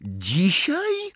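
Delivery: high, startled question; shocked cry. A narrator's reading. Hermiona learns results come today, crying out in shock. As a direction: pitch leaping up in disbelief. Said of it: "krzyknęła Hermiona".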